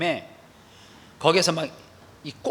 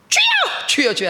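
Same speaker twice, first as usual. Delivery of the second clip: loud, high cry of Lord, Lord; crying out to God; 5 dB louder, slower than usual. Shown as a demonstration. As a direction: call out like a whole congregation praying aloud. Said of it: "통성으로 기도하겠습니다". (큰 소리로 높게 부르짖는 통성 기도)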